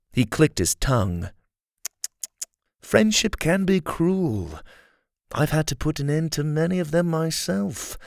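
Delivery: a scheming rebel leader flattering his captive; Kai, smooth villain. Acted. Kai taunting Dylan.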